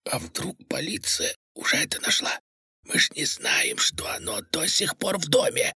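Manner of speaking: hushed, breathy whisper. A narrator's reading. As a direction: whisper it so nobody hears. Frightened accomplice whispering in dark house.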